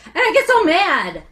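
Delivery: loud, high burst of real annoyance; exasperated outburst; real frustration. Real, not acted. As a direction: let the anger flare up. Speaker venting about bad advice.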